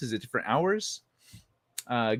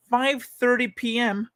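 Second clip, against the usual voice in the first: incredulous; 9 semitones higher, slower than usual. Retold or imitated. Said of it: "I said".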